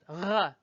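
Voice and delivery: ruh from the back of the mouth; throaty back-of-mouth ruh; French R sound. Shown as a demonstration. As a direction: Ruh with the tongue held back. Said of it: "If you hold your tongue back there".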